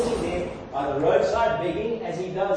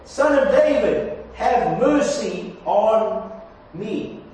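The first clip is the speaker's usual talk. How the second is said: raised, drawn-out plea for mercy; crying out more; 4 dB louder, slower than usual. Acted. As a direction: cry out all the more insistently. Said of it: "he cried out all the more".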